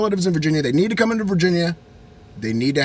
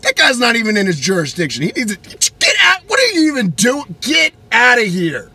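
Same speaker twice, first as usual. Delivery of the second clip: high, loud, scoffing rant; dismissive high-pitched yelling; defiant rant; 6 semitones higher, 4 dB louder than usual. Retold or imitated. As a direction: wave him off indignantly. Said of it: "I was like".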